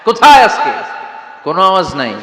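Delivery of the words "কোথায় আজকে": thundering demand. Acted. Preacher demanding where the tyrants are, shouting. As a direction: thunder out the question.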